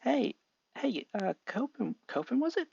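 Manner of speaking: put-on high, friendly boy voice; eager Izuku. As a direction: call out brightly to a stranger.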